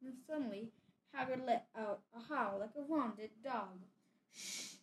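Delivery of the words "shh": sharp soft shush; hissed shush. A narrator's reading. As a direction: hiss it to silence him. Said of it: "hissed Professor McGonagall".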